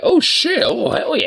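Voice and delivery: high-pitched mock excitement; hyped imagined reaction. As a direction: act out a thrilled, squealed reaction. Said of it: "I thought you'd be like".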